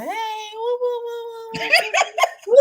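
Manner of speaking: put-on high, strained woo-woo cooing at a baby; mock panicked cooing. Holding nephew's baby, overwhelmed.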